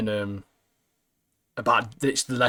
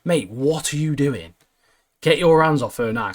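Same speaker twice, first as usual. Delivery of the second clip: stern, louder mock telling-off; 3 semitones higher, 4 dB louder than usual. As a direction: scold him like you're stepping in. Telling Eamon off, acted.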